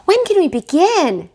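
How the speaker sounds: put-on high, loud eager child's call; eager shout. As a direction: call out, can't wait to start. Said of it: "shouted the Hopkins children".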